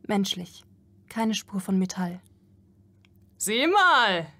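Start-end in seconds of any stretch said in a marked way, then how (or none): 3.4-4.3 loud, high call of a rough worker. A narrator's reading. Worker calling his mates to look at the girl, loud and high. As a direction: shout it out to grab the others' attention. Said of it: "rief einer".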